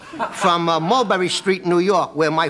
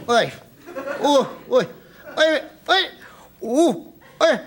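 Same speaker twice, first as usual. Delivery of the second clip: mock old man groans; 5 semitones higher than usual.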